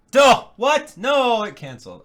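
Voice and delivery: loud, high yelps of frustration; dismayed outburst. Real, not acted. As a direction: yelp as the video fails on you. Video cancels on him.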